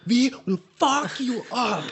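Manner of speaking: high, slow, mock heavy German accent; exaggerated German accent, threatening; German thug accent. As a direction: play the menacing East German, hammed-up and laughing. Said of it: "the leader of the East Germans keeps on going".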